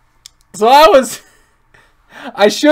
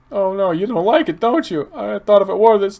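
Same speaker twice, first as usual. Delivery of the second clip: ordinary delivery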